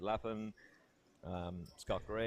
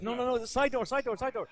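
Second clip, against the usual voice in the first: much higher and louder, urgent calling out; frantic shout; 7 dB louder than usual. Retold or imitated. Narrator shouting at Paul running out the front door. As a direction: yell after him to use the side door.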